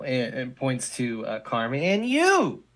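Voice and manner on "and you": high, loud accusing bark; single out the kid with a yell; pointed shout. Acted. Principal points at Carmen.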